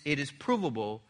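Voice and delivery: ordinary talk, nothing marked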